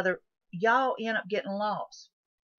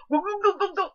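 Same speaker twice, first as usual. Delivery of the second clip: high, loud eager chant; hammed-up greedy chugging; greedy gulping; 10 semitones higher, 5 dB louder than usual. Thirsty hikers guzzling the bottle, loud mock chant. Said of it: "They go".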